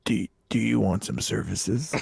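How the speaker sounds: low, slow imitated proposition; woman soliciting. Woman offering services to cat searcher, low and slow. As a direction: draw out a discreet retold offer. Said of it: "a woman comes up to me".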